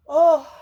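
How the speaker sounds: a loud, high yawning oh; big yawn. Real, not acted. Yawning mid-reading, then apologising to viewers, loud yawn. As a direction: let a real yawn break out. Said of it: "I'm sorry, I'm yawning".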